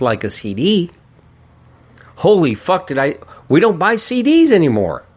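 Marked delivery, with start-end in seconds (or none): 2.2-5.1 sudden high, louder outburst; startled. Real, not acted. Podcaster realizes nobody buys CDs, surprised and higher. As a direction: catch yourself in real surprise. Catches himself mentioning CDs.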